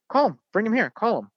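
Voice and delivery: mock owner urgently pressing him; eager insisting owner.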